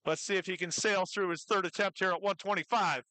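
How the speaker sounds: ordinary talk, nothing marked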